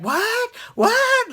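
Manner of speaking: squeaky high disbelieving what; mock-outraged high-pitched what; incredulous what. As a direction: shriek what twice in cartoon disbelief. Sandoval's outbursts acted out.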